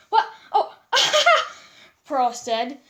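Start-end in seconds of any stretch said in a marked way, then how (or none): 0.9-1.5 short high burst of laughter. Acted. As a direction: laugh at the pun. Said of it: "noticing the pun".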